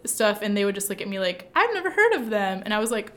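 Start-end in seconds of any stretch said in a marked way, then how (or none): 1.5-2.6 high-pitched mock Valley girl; Valley girl accent. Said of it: "I give them a Valley girl accent".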